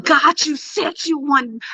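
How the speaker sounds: high-pitched excited cry to God; retold screaming. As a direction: cry it out high without raising volume. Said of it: "I was screaming".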